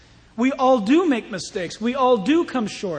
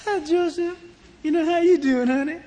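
high-pitched flirting woman; flirty Potiphar's wife; 5 semitones higher than usual. Acted. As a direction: coo it like a temptress. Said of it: "I don't think she talked like that".

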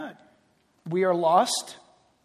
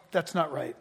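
pang of conscience; 5 semitones lower, 6 dB quieter than usual. Acted. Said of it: "stab of".

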